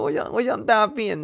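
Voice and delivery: high, squeaky imitation of the boy; hammed-up high kid voice; kid's urgent plea. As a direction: mimic the kid begging to go. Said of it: "他说".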